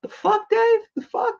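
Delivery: high squeaky disbelieving voice; imitating Triple H. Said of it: "Triple H was like".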